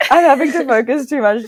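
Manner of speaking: laughing, dropping the American accent. Real, not acted. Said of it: "I can't do it anymore".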